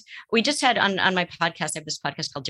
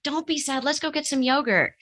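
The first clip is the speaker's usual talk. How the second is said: much higher, chirpy parent voice; cheer-up parent; 10 semitones higher than usual. Acted. Therapist mimics a parent distracting a sad kid, chirpy. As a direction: play a parent hurrying past the sadness.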